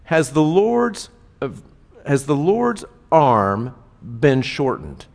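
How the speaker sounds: slow, solemn rhetorical question; God's rebuke. Retold or imitated. God answering Moses' doubt, slow and solemn. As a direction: let each word land with divine weight. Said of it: "But God says this".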